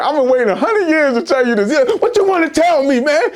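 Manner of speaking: high-pitched, heated build-up; imitated sitcom confrontation. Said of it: "like James Evans when he was like".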